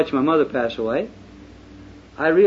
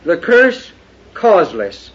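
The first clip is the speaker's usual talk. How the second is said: high, loud pulpit preaching; fiery preaching; 4 semitones higher, 7 dB louder than usual. Acted. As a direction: thunder it from the pulpit. Preaching at full force.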